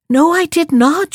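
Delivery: high indignant denial; protesting. Acted. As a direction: push back sharply at the accusation. Wife protests to husband.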